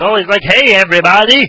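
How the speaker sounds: high, loud cartoon doctor greeting; Nick Riviera. Introducing the odd doctor voice, high and loud. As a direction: call out high with tongue pulled back. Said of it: "another odd voice from The Simpsons is Nick Riviera".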